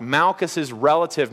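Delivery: usual speaking voice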